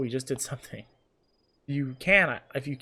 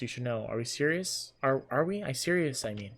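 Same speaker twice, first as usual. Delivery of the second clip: usual speaking voice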